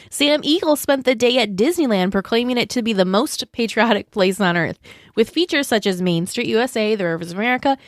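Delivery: scripted podcast news item; news read. Acted. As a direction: read the news copy brightly to listeners. Reads a scripted news segment.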